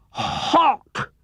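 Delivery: high mumble through a sore mouth; mumbled. Acted. Tigger telling Pooh his mouth hurts, high mumble. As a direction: squeak it out, tongue hurting. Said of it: "mumbled Tigger".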